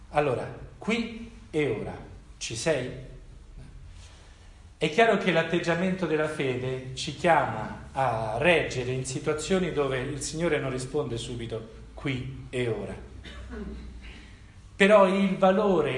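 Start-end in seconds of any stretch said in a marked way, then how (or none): none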